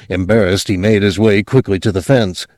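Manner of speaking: usual speaking voice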